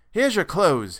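annoyed father snapping at his son. A narrator's reading. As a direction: throw the words at him in irritation. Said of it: "he snapped out".

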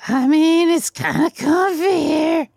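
high, slow, squealing elf; excited elf voice. Acted. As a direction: gush like an overexcited elf. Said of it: "you know me, Santa".

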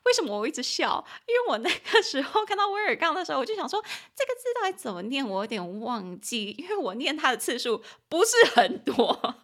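giggly, lively confession; real amused laughter bubbling through; laughing. Real, not acted. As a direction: explain yourself while breaking into giggles.

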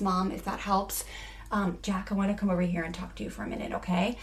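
soft, gentle reassurance; a mother checking on a child with sand in his eyes, soft and gentle; calm caring mom. Acted. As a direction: quietly soothe the upset kids. Calm listening mom role play.

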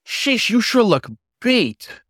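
high, slow, friendly cabbie; cab driver voice. A narrator's reading. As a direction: drawl it out like a kind driver. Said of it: "the Labrador ... commented".

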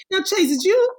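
high and loud, mock disbelief; a friend hearing fancy words, mock incredulous. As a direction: pitch it way up in amazed disbelief. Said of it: "I'm like".

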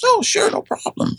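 high, airy quoted reply; breezy agreement. Retold or imitated. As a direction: lightly imitate an easygoing neighbour saying yes. Retold neighbour's breezy agreement.

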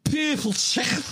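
very high, exaggerated Scouse; thick Scouse accent. Topping the co-host's takes, exaggerated high Scouse accent. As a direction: squeal "purple chicken" as a hammed-up Scouser. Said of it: "how thick can a Scouse accent get".

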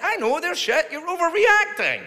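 high, squealed retort; mock exasperated protest. Comic retelling his retort about the sketches, mock exasperation. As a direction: protest high, dragging out the last word. Retold protest to the offended figure.

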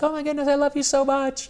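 high, sweet and gushing; gushing mom voice. Retold or imitated. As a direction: gush with love. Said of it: "And she's like".

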